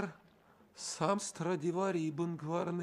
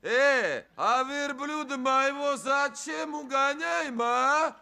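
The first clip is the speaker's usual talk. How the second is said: high, loud indignant yelling; excited shout; 7 semitones higher, 8 dB louder, slower than usual. Acted. The merchant yells at the men taking his camel, high loud indignant shout. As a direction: yell after the thieves.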